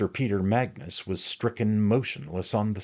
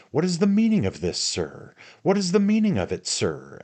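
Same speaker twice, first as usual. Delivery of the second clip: high, loud, fast demand; angry rising challenge; threatening, louder; 11 semitones higher, 7 dB louder than usual. A narrator's reading. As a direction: fire the question like an accusation. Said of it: "in a threatening and a louder tone".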